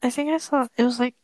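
ordinary talk, nothing marked

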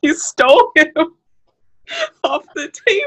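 high voice, breaking up mid-sentence; helpless laughter. Real, not acted. Host telling how he stole the patient, cracking up. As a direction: squeal the words out through giggles.

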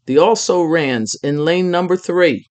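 loud, clear stadium announcement; announcer's call. A narrator's reading. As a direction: call the team to lane three. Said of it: "Loud and clear, he called".

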